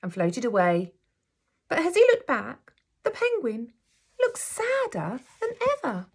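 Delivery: gentle, expressive storybook reading; teacher reading a picture book aloud to her class; reading to children. A narrator's reading. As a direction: read it like story time for little ones.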